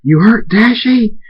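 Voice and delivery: slow, high, loud accusing shout; enraged accusation. Acted. Thunderstorm accuses Draconic as the storm builds, enraged shout. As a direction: drag out a furious high question.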